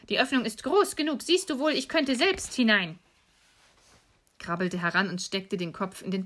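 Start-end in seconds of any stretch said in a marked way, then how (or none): 0.1-3.0 high, lilting impatient witch; scolding witch. Acted. Witch showing Gretel the oven, impatient scolding. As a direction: show her impatiently, in a raised voice. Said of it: "sagte die Alte".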